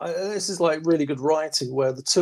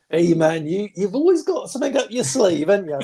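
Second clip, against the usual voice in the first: mock amazed admiration, lifting higher midway; acted surprise; 4 semitones higher than usual. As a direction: play the pleasantly astonished onlooker, lightly hammed-up. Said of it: "she looks surprised, like".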